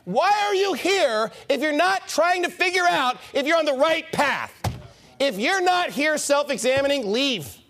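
impassioned, high-pitched scolding from the pulpit; exasperated rebuke. Real, not acted. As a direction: demand an answer from the congregation. Preacher challenging congregation with rebuke.

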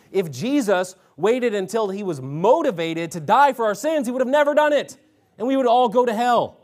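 fiery preaching climax; building preacher shout. Real, not acted. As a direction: drive it home hard at the end.

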